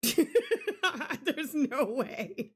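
very slow, broken up by laughter; laughing. Real, not acted. Player giving up on a hard word, laughing. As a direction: laugh your way slowly through the words.

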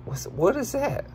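sudden high, soft startled squeak; surprised squeak. Acted. Reacting to a strange animal in a pool, startled high squeak.

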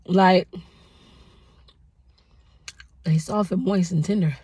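eating between words; talking while chewing. Real, not acted. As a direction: mouth full of donut. Seen 1: bites donut, holds it, keeps chewing between spoken phrases.

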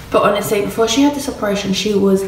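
ordinary talk

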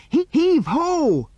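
long, high, loud hauling yell. A narrator's reading. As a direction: stretch out each word in a high yell. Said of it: "yelled Andy".

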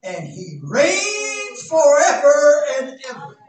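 loud, high, stretched preacher cry; climbing sermon fervor; full-force preaching. Acted. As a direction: preach it out to the whole church.

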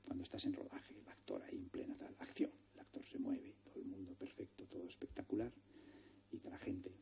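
whispered, as if the camera is rolling; hushed on set. Retold or imitated. Director describing a live take, whispered. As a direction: keep it to a whisper so you don't ruin the take.

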